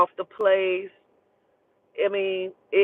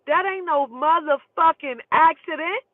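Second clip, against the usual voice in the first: higher, emphatic protest; retold indignant disbelief; 7 semitones higher than usual. Telling her sister it was no accident, indignant. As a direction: push back hard, pitch climbing.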